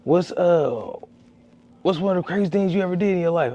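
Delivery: high-pitched interviewer impression; imitated street interviewer. Creators interviewing him downtown, imitated high-voiced interviewer.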